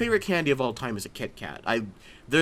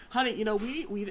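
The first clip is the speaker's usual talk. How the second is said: higher-pitched mock domestic voice; householder to spouse; 8 semitones higher, 4 dB quieter than usual. Householder raising insurance with a spouse, acted. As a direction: lightly play someone calling to their honey. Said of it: "like, someone's like".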